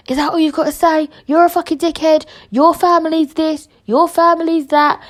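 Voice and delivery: high-pitched indignant retelling; retold telling-off. Podcaster re-enacts telling Lidl off, indignant. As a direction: rattle off the accusations, pitched high.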